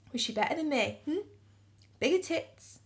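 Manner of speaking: acted jealous interrogation; jealous challenge. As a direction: challenge a cheating partner, voice jumping up at the end. Acts out questioning an unfaithful partner.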